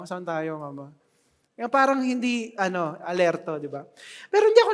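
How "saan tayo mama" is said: put-on sleepy child. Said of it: "parang bagong gising na bata".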